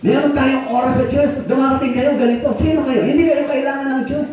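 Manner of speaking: high, sharp scolding rant; a preacher berating late churchgoers, angry and high; angry bishop scolding. Retold or imitated. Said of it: "galit na galit siya".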